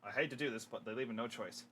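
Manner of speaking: low, hushed resignation; grave reluctance. Acted. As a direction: mutter it softly and reluctantly. Reluctant fighter in deep trouble.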